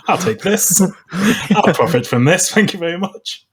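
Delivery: high, smug mock British toff; smug British coloniser. Mocking the British taking Irish ideas, in a smug posh voice. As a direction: lord it over them, taking the spoils. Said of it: "the British came along and was like".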